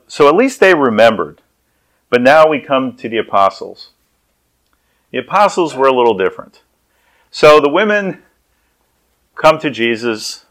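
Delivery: normal talking voice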